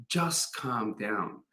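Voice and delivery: hushed, slow reassurance; God soothing. Retold or imitated. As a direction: settle a worried listener gently. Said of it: "And he says to me".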